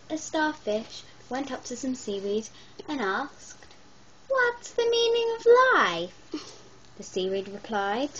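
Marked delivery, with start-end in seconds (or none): 4.3-6.1 high, loud and eager; eager high question. Acted. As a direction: ask it brightly, pitched up. Starfish asks seaweed.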